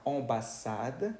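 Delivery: "ambassade" slowly, nasal first vowel; French pronunciation. Shown as a demonstration. Teacher translating embajada, slow French "ambassade". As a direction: draw out "ambassade" as on-ba-SAD.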